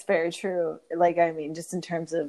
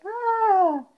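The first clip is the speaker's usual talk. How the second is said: high creepy gasp; 3 dB louder than usual. Retold or imitated. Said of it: "that character that you're like".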